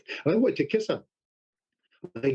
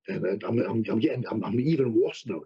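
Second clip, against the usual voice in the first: ordinary talk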